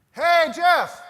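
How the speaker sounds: loud, high hearty shout of Jeff's name; coach calling out. Retold or imitated. Coach leans over the bed and calls his player loudly. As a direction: coach booming a wake-up greeting.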